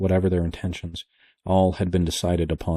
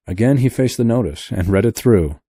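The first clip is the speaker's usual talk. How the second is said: usual speaking voice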